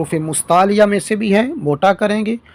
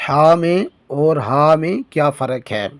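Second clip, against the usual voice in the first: usual speaking voice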